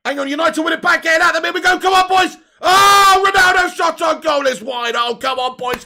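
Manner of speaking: loud, high, rising match excitement; excited shouting. Real, not acted. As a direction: roar the team on as they attack. United attack, Ronaldo shoots.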